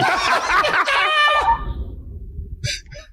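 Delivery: long, high-pitched laugh. Real, not acted. Prankster after the punchline of his story, long high laugh. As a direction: crack up helplessly at your own story.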